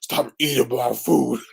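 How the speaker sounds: slow, lightly put-on stern host; a hint of a fussy host guarding the food; mock stern host. Imagined host scolding a house guest, slow and stern. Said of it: "would hate to be a house guest and hear".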